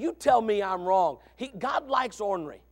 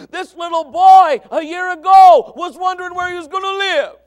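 shouted, high-pitched sermon climax; full-force preaching; 11 semitones higher, 16 dB louder than usual. Real, not acted. Preacher to congregation on a boy's rescue, full-force preaching. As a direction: drive each phrase out at full volume.

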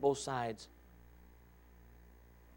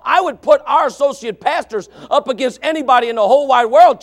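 loud, high, driving sermon shout; impassioned pulpit shouting; full-force preaching; 10 semitones higher, 16 dB louder than usual. Acted. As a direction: preach it hard to the whole church.

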